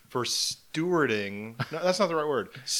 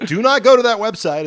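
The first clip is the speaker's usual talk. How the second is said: loud, higher sudden warning; 9 semitones higher, 10 dB louder than usual. Pretend. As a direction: shout the warning out. Mock warning about fake website.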